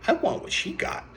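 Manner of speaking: put-on very high-pitched little kid voice; put-on child's voice, way up high; little girl. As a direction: pipe up high like an eager small child. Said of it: "The little girl pointed to and said to her mom".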